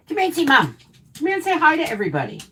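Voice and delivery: high, sing-song pet voice. Real, not acted. Baby-talking to her dog.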